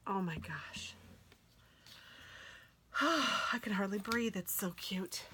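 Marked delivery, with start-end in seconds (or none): 0.1-0.9 breathless whispered gasp. Real, not acted. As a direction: whisper in awe. Whisper over cute kit.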